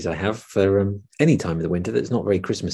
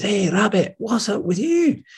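higher, slow heron greeting; slow, higher bird voice; 11 semitones higher than usual. Acted. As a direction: call out like a curious heron. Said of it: "the river and says".

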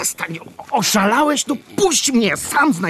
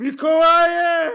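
calling out in sleep; 10 semitones higher, much slower than usual. Acted. Crying out in his sleep.

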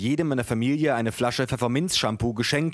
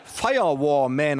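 slow, explanatory bad English with German accent; 2 semitones higher, slower than usual. A narrator's reading. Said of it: "erläuterte der Freund meiner Cousine". (langsames Englisch mit deutschem Akzent)

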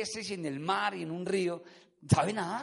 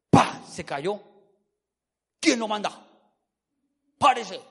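mock scolding in short bursts; scolding parent; 6 dB louder than usual. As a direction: bark at a fallen child like an impatient parent, acted. Acts scolding parents of fallen child. (regaño de padre, actuado)

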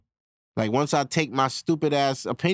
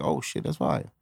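impressed reaction; 13 semitones lower, 4 dB quieter than usual. Shown as a demonstration. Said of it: "be like".

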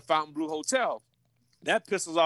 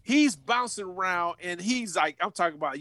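ordinary talk

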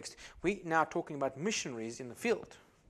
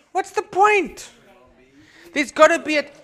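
very high, slow, loud outcry; exasperated cry; 13 semitones higher, 15 dB louder, slower than usual. Acted. Pastor questioning another degree, high and loud.

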